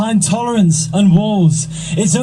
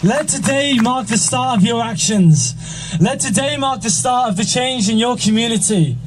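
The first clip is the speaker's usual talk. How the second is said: rally speech; 5 semitones higher, 4 dB quieter than usual. Acted. Closing rally speech, builds higher.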